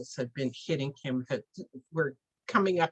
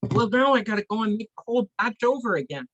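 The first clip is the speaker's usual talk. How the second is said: exasperated; 5 semitones higher, 4 dB louder, faster than usual. Acted. Medicine man complains about ruined batch.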